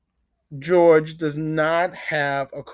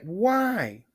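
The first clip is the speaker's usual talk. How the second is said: ordinary talk, nothing marked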